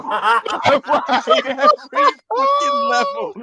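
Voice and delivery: cracking up while repeating it; laughing repeat. Shown as a demonstration.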